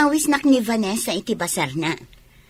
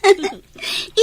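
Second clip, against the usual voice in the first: flirty giggle; 6 semitones higher, 3 dB louder than usual. Acted. Flirty banter about going along.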